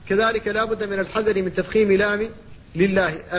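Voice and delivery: ordinary talk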